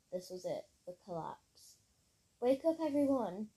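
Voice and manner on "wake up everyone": urgent yell, higher and louder. A narrator's reading. Molly wakes her friends as trees fall, urgent yell. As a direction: raise your voice to rouse sleepers. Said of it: "yelled Molly".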